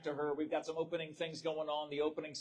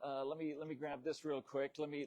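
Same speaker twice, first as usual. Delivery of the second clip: ordinary talk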